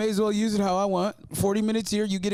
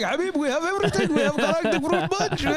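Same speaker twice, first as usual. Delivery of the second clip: put-on higher Arabic-accented clerk, with a cheery Habib; 9 semitones higher than usual. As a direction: warmly sell every flavor in the clerk's Arabic accent. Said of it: "And then in that voice, too, he's like".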